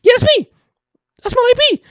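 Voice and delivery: very high, loud cartoon girl. [name]'s cartoon confessing, very high and loud. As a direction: squeak it like a cartoon.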